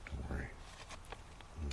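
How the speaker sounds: ordinary delivery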